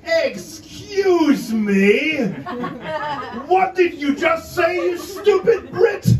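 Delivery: hammed-up rude German challenge; mock aggressive shout. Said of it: "to the English guy sounded like".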